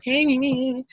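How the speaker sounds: tiny, very high, soft squeak; mock secretary, high and quiet; imitated secretary. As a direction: put on a high little voice.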